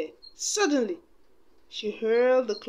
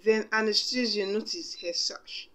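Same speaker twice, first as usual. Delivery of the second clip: usual speaking voice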